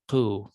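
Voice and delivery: "ku" with a guttural pop at the back of the throat. Shown as a demonstration. Teacher finishing the Q row for students, guttural "ku". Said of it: "kind of a pop in the back of the throat".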